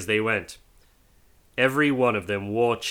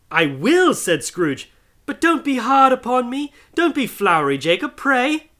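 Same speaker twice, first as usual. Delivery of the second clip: higher, nervous begging; pleading Scrooge; 13 semitones higher, 4 dB louder than usual. Acted. Scrooge begging Marley's ghost, nervous. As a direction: beg him to go easy.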